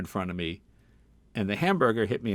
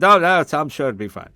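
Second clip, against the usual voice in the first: high, loud brushing-off voice; dismissive dad; 6 semitones higher, 11 dB louder than usual. Retold or imitated. Dad waves off son's warning about prices, loud dismissive voice. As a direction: wave off the son's worry.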